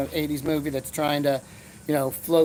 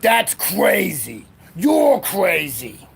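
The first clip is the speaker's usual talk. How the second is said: put-on high, loud and very slow old cop; grizzled sergeant; 4 semitones higher, 6 dB louder, much slower than usual. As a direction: drag it out like a gruff old sergeant after a long pause. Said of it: "Taciturn Lou looks at him and goes".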